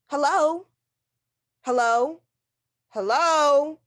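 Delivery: loud, urgent hellos; calling into phone. Acted. Invalid calling into the dead phone, frantic. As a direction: shout down the line for anyone.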